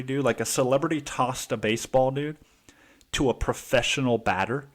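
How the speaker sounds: ordinary delivery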